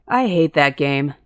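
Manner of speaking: slow, drawn-out moan; a touch of a whiny moan. A narrator's reading. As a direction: grumble it like a sulky brother. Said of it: "Jack moaned".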